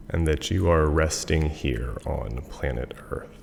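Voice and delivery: guided meditation. Acted. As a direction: ease the listeners down to rest. Leads a Yoga Nidra meditation.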